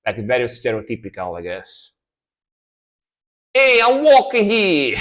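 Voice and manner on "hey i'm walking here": put-on loud, slow New York accent, stretching "walking"; New Yorker accent. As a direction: bark it like an annoyed New Yorker on the street. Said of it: "Like New, New Yorker".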